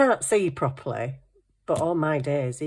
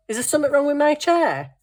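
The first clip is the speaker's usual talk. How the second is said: worried; 10 semitones higher, 5 dB louder, faster than usual. Retold or imitated. Mum's worried question.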